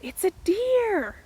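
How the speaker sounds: high, slow exclaim of relief; sibling peering over a shoulder, surprised high exclaim; surprised discovery. Retold or imitated. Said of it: "looks back and says".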